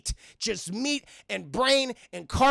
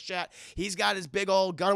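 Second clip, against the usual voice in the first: usual speaking voice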